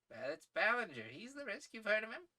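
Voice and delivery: lightly voiced, lively gentleman adventurer; sporting English adventurer.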